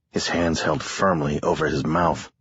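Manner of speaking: ordinary delivery, nothing marked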